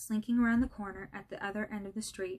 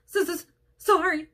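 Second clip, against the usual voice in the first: slow, high, loud stammered sob; sobbed apology; 8 semitones higher, 8 dB louder, slower than usual. A narrator's reading. The giant apologising to McGonagall, sobbing stammer. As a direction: stutter it out through tears. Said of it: "sobbed Hagrid".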